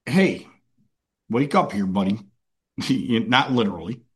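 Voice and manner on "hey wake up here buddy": casual nudge. Acted. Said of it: "a voice spoke to him a second time and said".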